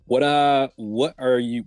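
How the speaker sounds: usual speaking voice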